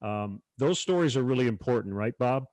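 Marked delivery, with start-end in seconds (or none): none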